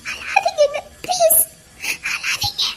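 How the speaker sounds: high, thin pleading; tearful plea. Acted. Woman begging a crying man to stop, tearful plea.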